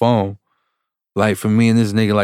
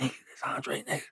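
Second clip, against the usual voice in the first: hushed, unvoiced retold whisper; 3 semitones higher, 18 dB quieter, much faster than usual. Storyteller secretly tells his friend who that is, whispering. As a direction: whisper it under your breath to a friend.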